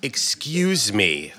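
slow, hesitant excuse me; halting, unsure excuse me; nervous slow call; stammered. Acted. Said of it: "I stammer".

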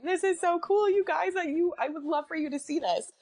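crying and amazed at once, calling up to her dads; high, tear-strained wonder; crying to her late fathers. Retold or imitated. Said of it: "I'm in my car crying".

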